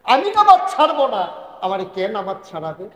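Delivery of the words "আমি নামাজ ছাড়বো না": high, loud declaration of decision; firm, final resolve. Shown as a demonstration. Preacher shows a final decision never to drop prayer, resolute cry. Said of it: "that's not the final".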